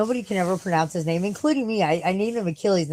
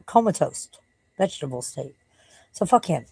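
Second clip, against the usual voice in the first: usual speaking voice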